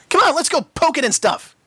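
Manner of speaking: eager. A narrator's reading. Eager filly urges friends.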